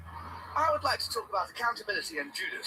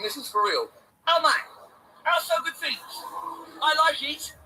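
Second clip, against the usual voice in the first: high, loud, gleeful chant; excited catchphrase; 6 semitones higher, 4 dB louder than usual. Acted. Vlogger celebrating a lucky day, high loud glee. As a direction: crow over a lucky win.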